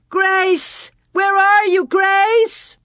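high, loud searching cries; shouting. A narrator's reading. Parents searching the slough for Grace, shouting. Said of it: "came Pa's shouts and Ma's".

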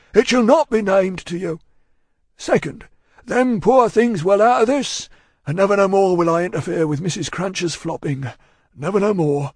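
loud, high, weighty Cockney voice; solemn vow. A narrator's reading. Cruncher vowing to Miss Pross to reform, solemn. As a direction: swear it earnestly and forcefully.

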